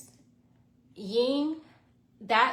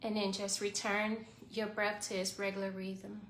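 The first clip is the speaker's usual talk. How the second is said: guided meditation; 7 dB quieter than usual. Acted. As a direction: calmly guide the breathing. Leads a short guided meditation.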